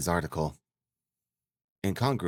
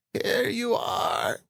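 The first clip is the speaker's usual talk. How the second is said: high, slow, joyful mother's greeting; faltering with joy; 3 dB louder, much slower than usual. A narrator's reading. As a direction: greet your son overcome, drawing the words out. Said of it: "faltering with joy".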